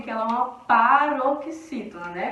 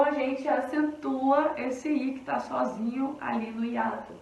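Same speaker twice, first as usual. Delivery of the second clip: ordinary talk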